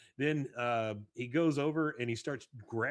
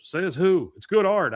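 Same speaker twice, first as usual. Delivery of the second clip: loud, raised-pitch retort; mock defiance; 7 semitones higher, 10 dB louder than usual. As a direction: fire back in an acted argument. Hank defiantly argues with dealer.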